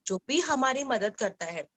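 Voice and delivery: usual speaking voice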